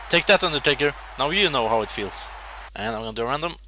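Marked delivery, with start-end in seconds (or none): none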